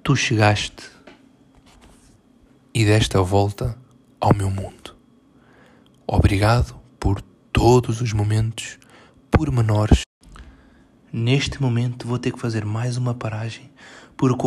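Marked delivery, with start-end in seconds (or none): 0.0-10.0 slow, louder, mock-solemn recital dropping into a breathy whisper; mock poem recital. Host mocks an ex's love post as a poem, hammed-up. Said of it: "vou ler isto como se isto fosse um poema".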